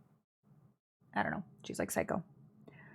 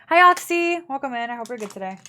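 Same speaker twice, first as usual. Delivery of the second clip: cheery greeting; 3 semitones higher, 7 dB louder than usual. Real, not acted. Streamer greeting chat viewer.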